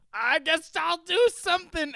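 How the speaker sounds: high, loud, half-hearted shrug; squeaky reluctant teammate. Retold or imitated. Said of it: "and he's like".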